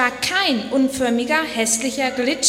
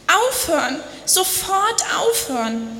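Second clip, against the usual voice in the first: higher mock wail; screaming and wailing; 7 semitones higher than usual. A narrator's reading. Clay wailing at the potter, lightly acted. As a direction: wail for him to stop. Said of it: "Ich schrie und jammerte".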